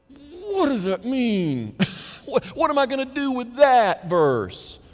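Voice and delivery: high, drawn-out mock exasperation; exaggerated high-pitched puzzlement; acted bafflement. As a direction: whine it slowly, like you're stumped and stuck. Acting out his struggle with verse.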